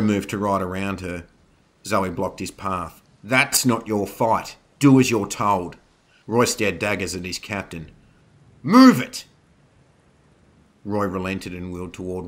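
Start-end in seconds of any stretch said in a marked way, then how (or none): 3.2-5.8 loud, forceful rebuke; commanding, emphatic telling-off; stern order. A narrator's reading. Captain rebukes rider in battle. 8.6-9.2 very loud, high bark; explosive, impatient order; shouted command. A narrator's reading. Captain orders rider onward.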